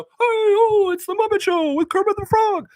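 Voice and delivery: very high, loud Muppet show-opener; hammed-up Muppet host; Kermit the Frog. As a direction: announce it in a strained puppet voice. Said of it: "like, on the Muppet Show".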